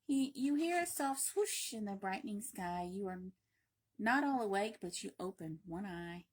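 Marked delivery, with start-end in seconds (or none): none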